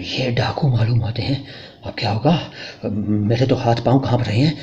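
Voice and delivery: scared husband whispering to his wife; frightened whisper. Acted. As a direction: whisper so the robbers won't hear. Husband fears dacoits at night.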